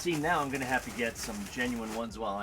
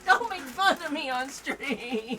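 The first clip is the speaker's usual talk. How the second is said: whiny; 12 semitones higher, 6 dB louder, slower than usual. Pretend. Mock pleading with streaming partner.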